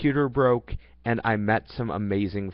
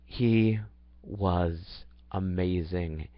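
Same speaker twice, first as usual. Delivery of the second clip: soft, slow and fond, voice dropping away; tender and slow; 4 semitones lower, 3 dB quieter, much slower than usual. Real, not acted. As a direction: linger on it tenderly. Describing the love of his life.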